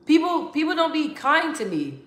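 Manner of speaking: high, upset protest; emotional outburst. Real, not acted. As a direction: voice jumps high, upset and pleading. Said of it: "sorry, guys, if I got all emotional".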